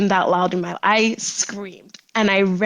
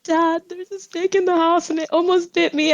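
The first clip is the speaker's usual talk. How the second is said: panicked; 8 semitones higher than usual. Retold or imitated. Panicked daughter telling dad.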